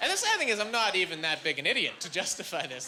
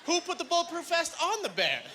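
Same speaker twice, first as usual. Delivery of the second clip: high, insistent mock indignation; incredulous rant; 10 semitones higher than usual. Comic arguing with a physics problem, incredulous rant. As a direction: push the question high, then drop at the end. Ranting at the absurd physics question.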